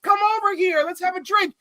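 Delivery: high, eager mock invitation; inviting. Jason Momoa inviting Scotty for a drink, high and eager. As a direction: call him over keenly. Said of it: "He's like".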